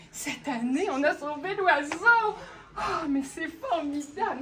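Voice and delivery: loud, slow joyful cry; joyful exclaim. Acted. Villagers loudly rejoicing at saving the bird. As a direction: proclaim it with joy.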